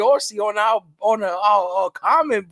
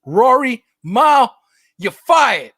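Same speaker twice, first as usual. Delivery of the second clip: loud, punched pronouncement; mock firing; 3 semitones higher, 8 dB louder, much slower than usual. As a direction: call out each name like a boss firing staff. Mock firing announcement, word by word.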